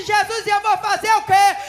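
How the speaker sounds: loud, high pleading question; anxious cry. Retold or imitated. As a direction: shout back in helpless alarm. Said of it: "E eu disse". (clamor aflito e alto)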